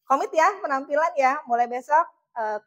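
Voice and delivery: normal talking voice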